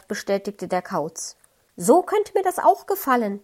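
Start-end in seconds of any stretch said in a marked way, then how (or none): 1.8-3.4 high, chirpy little-bird voice; small bird hoping for a ride, piping high. A narrator's reading. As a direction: peep it like a tiny blue bird. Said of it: "piepste der kleine blaue Vogel".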